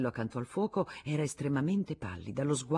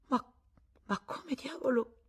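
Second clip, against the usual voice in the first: high, stammering astonishment; stunned; 11 semitones higher than usual. Acted. Husband caught out by his wife, stunned. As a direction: gasp it out, caught. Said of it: "disse Arnoux stupefatto".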